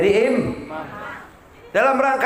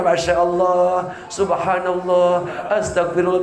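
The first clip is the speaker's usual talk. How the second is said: pious ustad; 3 semitones lower, slower than usual. Retold or imitated. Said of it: "Tuh ustad mah ngomongnya begitu".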